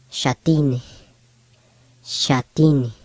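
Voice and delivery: shatin with X said as SH; SH sound. Shown as a demonstration. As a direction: say shatin opening on a clear SH. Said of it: "an S H type sound".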